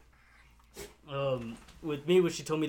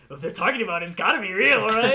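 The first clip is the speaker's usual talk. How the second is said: higher, louder mock-kid logic; five-year-old's reasoning; 6 semitones higher, 8 dB louder than usual. Recalling his childhood belief in the ghost train, excited kid voice. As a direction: build up in pitch and volume to an eager "right?". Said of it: "five-year-old head".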